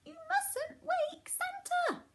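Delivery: very high, slow squeaking warning; piping mouse scolding; squeaky mouse. A narrator's reading. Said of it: "squeaked the Santa's little mouse".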